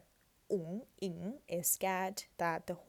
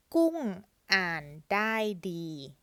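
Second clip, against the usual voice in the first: slow careful reading; 3 dB louder, much slower than usual. Shown as a demonstration. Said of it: "reads the full sentence".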